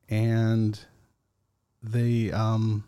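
ordinary delivery

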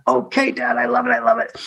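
put-on high, eager child's voice; excited kid voice. Sons cheering their dad on, high and eager. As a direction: play the thrilled young son.

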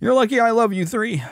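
high, sweet talk to the cats; doting pet voice. Retold or imitated. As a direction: coo at your pets fondly. Talking fondly to cats.

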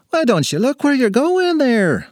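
high, slow scolding from a little man; indignant little leprechaun. Acted. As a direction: scold her in a put-on leprechaun voice. Bumped man scolds the girl.

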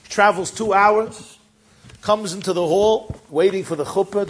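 ordinary delivery

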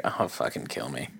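low, quiet groaning complaint; miserable polar bear. Acted. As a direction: voice a polar bear wilting in summer heat. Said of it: "He's, like, laying on a rock".

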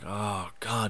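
low, quiet groan of dread; retold dismay. Wrestling fan retelling his reaction to the planned match, quiet dismay. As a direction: sink down softly, mildly dismayed.

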